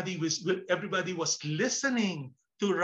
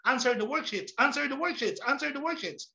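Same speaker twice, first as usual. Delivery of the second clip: higher, repetitive commanding chant; nagging teacher; 5 semitones higher than usual. Acted. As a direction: drill the order into the class. Teacher parodied pushing worksheets on class.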